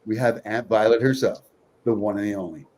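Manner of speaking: ordinary delivery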